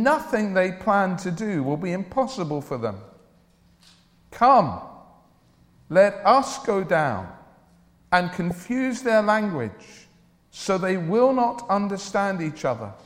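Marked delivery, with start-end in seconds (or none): none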